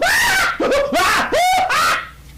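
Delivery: a big high whooping laugh; loud burst of laughter. Real, not acted. Preacher laughing loudly at the scene he paints. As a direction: crack up out loud at it.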